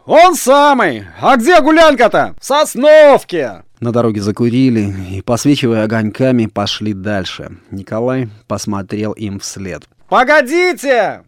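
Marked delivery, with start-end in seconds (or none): 0.0-1.0 high, loud, drawn-out call; stretched ringing shout; shouted reply. A narrator's reading. Shouted exchange across a distance. 1.2-3.6 high, loud, ringing calls; long-range hollering; shouted exchange. A narrator's reading. Shouted exchange across a distance. 10.1-11.2 high, loud, drawn-out call; long ringing shout; sudden shout. A narrator's reading. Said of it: "крикнул он вдруг".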